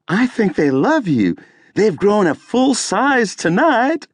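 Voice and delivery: high, camp diva voice; campy drag queen. Acted. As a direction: tease the crowd like a drag diva. Drag queen teasing the crowd.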